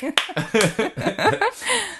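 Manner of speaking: squealing high laugh. Real, not acted. Laughing at own accent.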